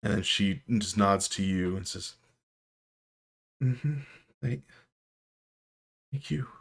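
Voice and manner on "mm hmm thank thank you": holding back tears. Acted. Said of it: "pinpricks of tears".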